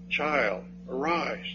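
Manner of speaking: put-on quiet, gentle "Child, arise"; soft command. Shown as a demonstration. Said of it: "Two words".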